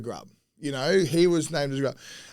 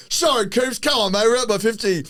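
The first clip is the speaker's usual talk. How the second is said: higher-pitched mock protest to an angry teammate; retold pleading apology; 6 semitones higher, 4 dB louder than usual. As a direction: plead your case, half laughing.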